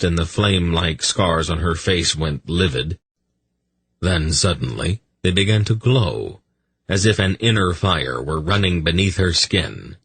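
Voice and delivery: plain reading voice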